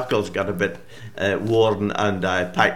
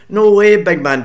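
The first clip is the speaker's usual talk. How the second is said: higher, louder piping reply; boy piping up at the giant, higher and louder; 10 semitones higher, 11 dB louder than usual. Acted. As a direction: pipe up boldly at the giant. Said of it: "Davy pipes up".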